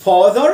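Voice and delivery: father with a long, full "aa"; deep open A. Shown as a demonstration. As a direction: open up the first vowel of father. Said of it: "ال A هنا بنطقها آ مفخمة".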